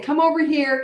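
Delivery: high-pitched imitation of coaxing; calling a child. Parent directly summoning toddler, high coaxing call. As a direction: call it as a parent beckoning a little one.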